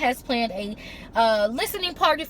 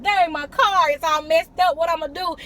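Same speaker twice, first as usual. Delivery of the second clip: mock upset dude; 5 semitones higher, 4 dB louder than usual. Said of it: "They'll come back to you like".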